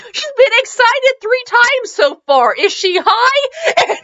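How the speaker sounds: high, slow, laughing shout; laughing disbelief. Real, not acted. Host cracking up, high and laughing at the heroine. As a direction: crack up at how odd the story is.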